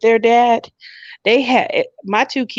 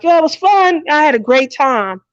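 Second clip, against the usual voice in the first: breezy innocence; 7 semitones higher than usual. Retold or imitated. Breezy cover story to kids.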